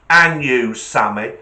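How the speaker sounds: loud retort hurled at the gorilla; acted angry call-out; confronting shout. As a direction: shout it back defiantly.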